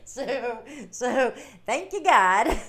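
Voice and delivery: laughing with relief; loud laughing thanks. Real, not acted. Laughing relief after fixing stream.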